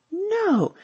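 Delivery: high, drawn-out exclamation; amazed disbelief. A narrator's reading. Sally hears of two puppy orders, amazed disbelief.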